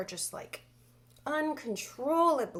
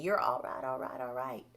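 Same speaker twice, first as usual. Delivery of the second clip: Matthew McConaughey; 9 semitones lower, 4 dB quieter than usual. Acted. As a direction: let it sink low and easy. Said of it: "female Matthew McConaughey".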